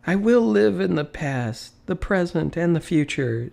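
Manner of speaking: high, ringing vow of a reborn man; joyful vow. A narrator's reading. Scrooge vowing to live in all three times, ecstatic. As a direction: proclaim it with rising joy. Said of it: "Scrooge repeated".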